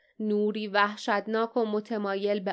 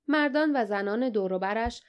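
ordinary delivery, nothing marked

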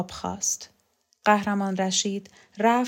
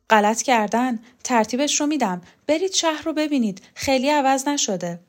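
higher, animated and dismissive of the ban; brash reassurance; 5 semitones higher, 5 dB louder than usual. A narrator's reading. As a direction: wave the objection away with raised, lively insistence. Brushes off ban, urges outing. (با صدایی بالاتر، قاطع و پرشور)

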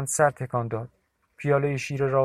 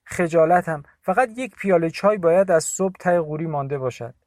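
put-on raised, higher old woman's blessing; Bibi's voice; 4 semitones higher, 6 dB louder than usual. A narrator's reading. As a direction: call out a worried blessing to the visitor. (صدای پیرزن، بلند و نگران)

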